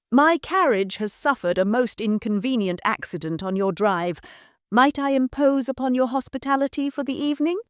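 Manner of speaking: lightly voiced refined gentleman; polished stranger asking politely; cultured gentleman. Gentleman asks shelter.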